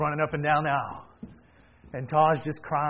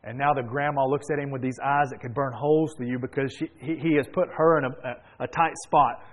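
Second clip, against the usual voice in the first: ordinary talk, nothing marked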